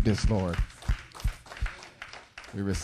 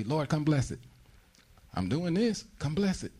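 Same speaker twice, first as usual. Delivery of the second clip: pleading believer; 7 semitones higher, 5 dB quieter than usual. Retold or imitated. Believer demanding God bless plans.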